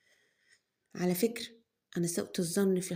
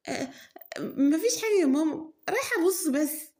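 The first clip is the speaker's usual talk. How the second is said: innocent daughter; 9 semitones higher, 4 dB louder than usual. Acted. Daughter answers mother, higher put-on voice.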